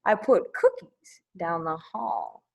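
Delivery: usual speaking voice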